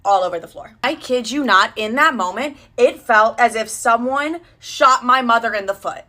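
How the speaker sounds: ordinary delivery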